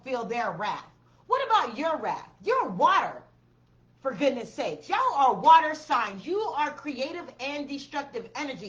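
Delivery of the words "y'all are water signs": loud emphatic call. Real, not acted.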